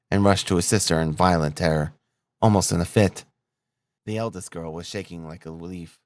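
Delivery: usual speaking voice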